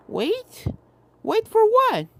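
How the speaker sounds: sudden fear in the question; alarmed filly. A narrator's reading. Apple Bloom asking the ghosts what they wait for, alarmed.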